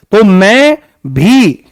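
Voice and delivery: slow, drawn-out भी; stress on भी. Shown as a demonstration. Teacher showing students the emphasis, drawn-out भी. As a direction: hold and press भी. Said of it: "भी में जोर दिया जा रहा है".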